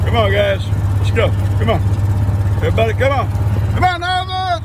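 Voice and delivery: high, sing-song call to the animals; calling the herd. Real, not acted. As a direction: call them in from across the field.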